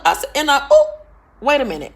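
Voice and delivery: ordinary talk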